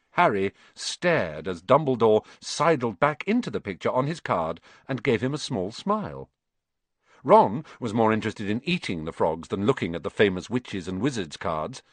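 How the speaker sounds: plain reading voice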